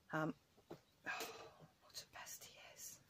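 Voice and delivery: slow breathy whisper with sighs; whispered exasperation. Real, not acted. Fed-up owner whispering about her cat. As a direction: sigh it out under your breath.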